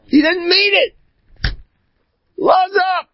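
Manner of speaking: very high exasperated cry; exasperated outburst. Acted. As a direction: burst out high, dismissing the fuss. Animated pleading to calm down.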